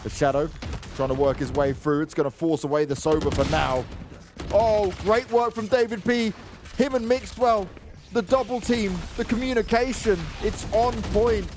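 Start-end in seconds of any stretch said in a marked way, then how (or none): 4.5-11.5 excited commentary. Real, not acted. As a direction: lift right up for the big play. Big play in the round.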